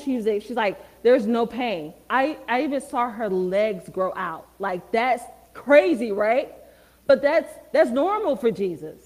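preaching at full force. Acted. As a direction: lift the room with it, preaching hard.

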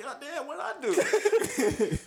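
high, slow, laughing what-did-I-do; laughing disbelief. Retold or imitated. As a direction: drawl it out through laughter, high. Retelling jail holding cell.

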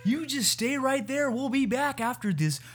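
high, swooping broadcast patter; sing-song host, stretching the last words; radio host impression. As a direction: tease the break like a cheesy announcer. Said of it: "probably the best impression you do".

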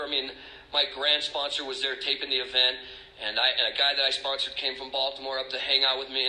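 ordinary talk, nothing marked

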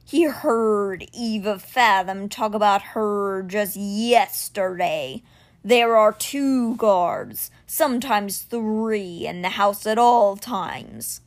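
very slow, grave creature voice; measured like a great stone beast; stone cat Simber. Acted. Stone guardian bringing news to Alex, slow and grave.

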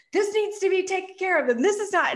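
high, loud, frazzled nagging; frantic nagging. Retold or imitated. Stressed mom nagging around the house, high and frazzled. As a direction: fuss over the messy house, frazzled.

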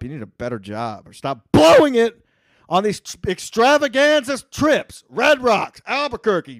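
loud, high yelling rant; angry rant. Real, not acted. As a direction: shout him down in fury. Ranting at co-host, much louder.

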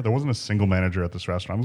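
ordinary talk

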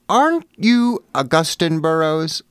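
high, slow, loud woman's voice; imitated gushing fan. Well-dressed woman approaches author at supermarket, high drawn-out mock voice. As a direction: put on an older lady's drawn-out, high-pitched recognition.

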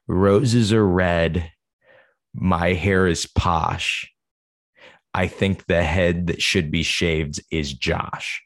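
very slow mock-solemn poem reading; poem recital. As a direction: recite it like a grand verse. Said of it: "he wrote a poem".

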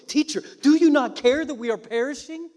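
high, loud desperate plea; panicked plea. Acted. Disciples to sleeping Jesus, loud panicked plea. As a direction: beg him in terror over the storm. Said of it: "this was the panic in their voice".

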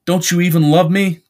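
high, loud accusing question; wounded demand. Acted. Jimmy challenges Charlie's love, loud and demanding.

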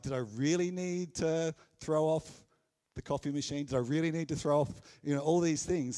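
exasperated, acted complaint with stress on "really"; mock regret. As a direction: act out a sailor second-guessing the loss. Voicing sailors' regret after the storm.

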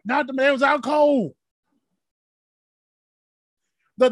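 fervent sermon delivery, swelling to shouts; preaching. Acted. Preacher urging his congregation, fervent rising preaching.

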